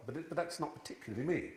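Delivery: ordinary talk, nothing marked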